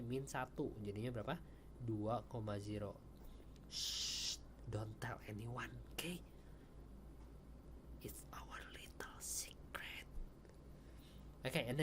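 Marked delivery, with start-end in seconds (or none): 3.7-4.4 drawn-out "shh"; playful shush. Pretend. Teacher hushing students over a secret trick, playful shush. 4.7-10.0 playful secretive whisper. Pretend. Teacher letting students in on a trick, secretive whisper.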